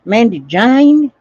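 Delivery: slow drawled "Mandy Jane" with rising lilt; Southern drawl. Pretend. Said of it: "That's Southern talk".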